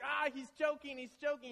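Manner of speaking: high, loud alarm that he's choking; panicked cry. Retold or imitated. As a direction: shriek it in a panic. Panicked onlooker.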